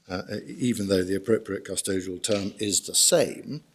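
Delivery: ordinary delivery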